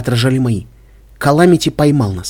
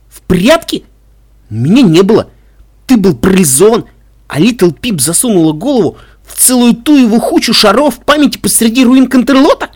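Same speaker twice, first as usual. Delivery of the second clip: high, loud roar; 8 semitones higher, 5 dB louder than usual. A narrator's reading. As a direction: bellow it out in fury. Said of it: "проревел Каламити".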